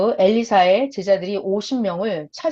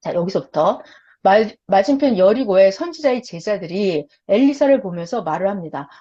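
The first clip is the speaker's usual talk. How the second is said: normal talking voice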